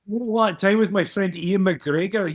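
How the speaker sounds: high Scottish reporter voice, slowing down; Scottish sports reporter, pitched high; Ian McGregor voice. Pretend. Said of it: "his name is Ian McGregor".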